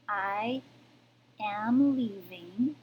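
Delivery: usual speaking voice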